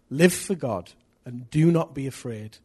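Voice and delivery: ordinary talk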